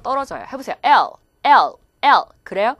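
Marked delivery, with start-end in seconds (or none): 0.8-2.2 loud "L" with tongue tip behind upper teeth; touch and release the tongue on each "L"; L sound. Shown as a demonstration. Teacher drilling students, "L" tongue behind teeth. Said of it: "윗니 뒤쪽에 혀끝이 붙었다".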